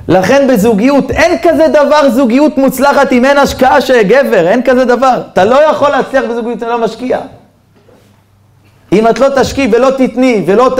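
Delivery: high-pitched, insistent sermon delivery; exhorting the congregation, pitched high; emphatic preaching. Real, not acted. Preacher drives home the moral.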